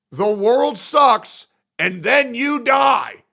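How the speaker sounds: slow bitter shout; slow shouted rant. Real, not acted. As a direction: declare it like a final verdict. Ranting conclusion.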